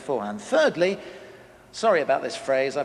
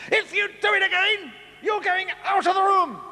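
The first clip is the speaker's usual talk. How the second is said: much higher, strained mock-exasperated threat; exasperated final warning; 4 dB louder than usual. Parent's final warning at the table, mock exasperated. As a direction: shriek the threat at the end of your tether. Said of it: "the parents say".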